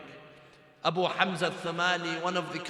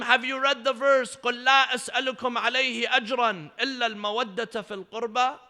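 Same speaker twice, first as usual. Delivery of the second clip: raised, loud preacher's recital; forceful challenge; 6 semitones higher, 6 dB louder, much faster than usual. Retold or imitated. Imam challenging a doubting man with scripture, forcefully retold. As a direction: press the question loudly, then recite the verse.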